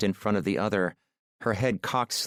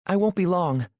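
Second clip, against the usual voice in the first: put-on higher, bright-eyed nervous young woman; voiced shy woman; 10 semitones higher, 4 dB louder than usual. A narrator's reading. Aurora agrees to go up and shower, nervously higher. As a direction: lift the pitch for shy Aurora.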